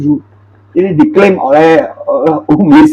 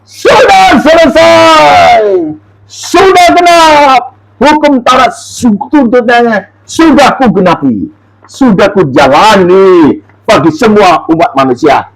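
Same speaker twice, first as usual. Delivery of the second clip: shouted declaration of victory; triumphant shout; 4 semitones higher, slower than usual. Acted. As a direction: cry it out in victory, drawn out. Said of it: "Itu berseru".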